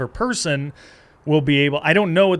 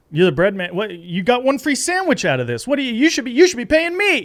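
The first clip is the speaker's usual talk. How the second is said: indignant customer turning the blame on the bread man; 9 semitones higher than usual. Shown as a demonstration. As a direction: push back harder with each line. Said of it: "as opposed to being like".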